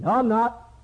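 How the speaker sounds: high, acted protest; preacher acting an indignant denial; insistent denial. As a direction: deny it firmly, voice jumping up. Peter denies being a disciple.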